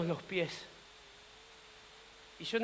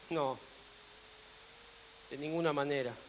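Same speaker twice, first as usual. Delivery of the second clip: soft, low and certain; quiet denial; 7 semitones lower, 14 dB quieter than usual. Acted. Peter rules out Satan as his enemy, quietly firm. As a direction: drop right down to dismiss the idea.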